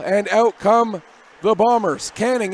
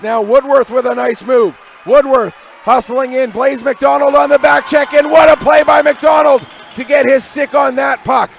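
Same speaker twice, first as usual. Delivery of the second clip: rising, louder play-by-play as the play builds; loud, thrilled hockey call; excited call; 5 semitones higher, 8 dB louder than usual. Real, not acted. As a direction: let it swell with the rush up ice. Play-by-play of a big defensive play.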